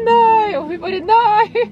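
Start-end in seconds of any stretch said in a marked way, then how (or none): 0.1-0.5 high, loud wailing no; mock despairing cry of no; dramatic no. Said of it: "Så dramatisk". 1.0-1.6 high, loud wailing no; mock despairing cry of no; dramatic no. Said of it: "Så dramatisk".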